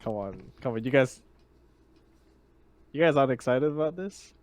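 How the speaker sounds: usual speaking voice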